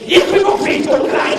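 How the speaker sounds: harsh German. Shown as a demonstration. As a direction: spit the German words out harshly like a caricature. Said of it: "Know how it sounds in German?".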